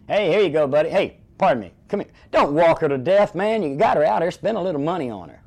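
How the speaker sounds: loud, high teasing holler; barker call-out. Retold or imitated. Barker teasing a man walking his girl in circles. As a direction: rib the guy loudly so everyone hears. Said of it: "I'd say something like".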